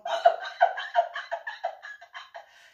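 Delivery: breathy burst of laughter; can't hold back the laugh; real laughter. Real, not acted. Laughing at the memory.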